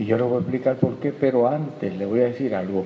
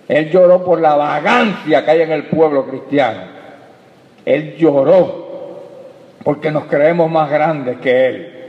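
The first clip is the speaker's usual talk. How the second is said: loud, raised preacher's voice; full-force preaching; 3 semitones higher, 6 dB louder than usual. Real, not acted. Preacher scolding a church too idle to go out, full-force preaching. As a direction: drive each charge home loudly to the congregation.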